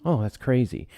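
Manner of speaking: ordinary delivery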